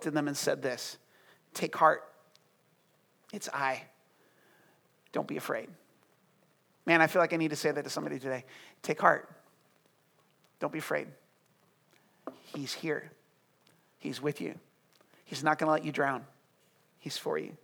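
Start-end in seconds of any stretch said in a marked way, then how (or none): 1.5-5.7 low, hushed, comforting voice; quiet and tender, unhurried; gentle reassurance. Acted. Voicing Jesus calming frightened disciples. 8.8-17.6 low, hushed comfort to listeners; quiet, tender encouragement; gentle reassurance. Real, not acted. Preacher softly reassures the congregation.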